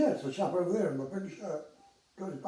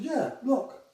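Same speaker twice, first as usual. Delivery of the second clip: excited; 10 semitones higher, 4 dB louder than usual. Retold or imitated. Quoting himself excited.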